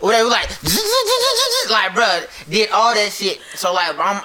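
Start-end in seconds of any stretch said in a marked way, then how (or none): 0.5-1.7 high buzzing race-car tool sound; rapid mouth-made pit crew noise; imitated pit-stop noise.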